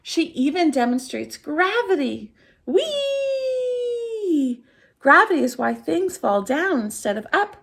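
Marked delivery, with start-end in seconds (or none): 2.7-4.6 high, long gliding squeal; playful falling whoop; gleeful whee. Acted. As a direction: let it glide down with delight. Fairy falls demonstrating gravity.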